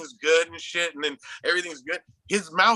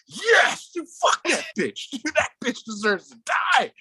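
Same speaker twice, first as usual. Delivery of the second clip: retold screaming; 8 semitones higher than usual. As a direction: re-enact yelling at the screen. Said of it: "I was screaming".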